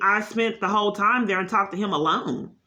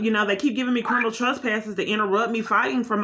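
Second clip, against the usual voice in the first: usual speaking voice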